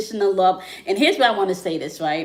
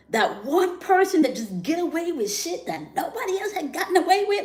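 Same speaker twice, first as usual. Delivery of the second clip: high-pitched disbelieving repeat; much higher emphatic repeat; 9 semitones higher than usual. Real, not acted. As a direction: repeat it up high, pitch climbing to the top of her range.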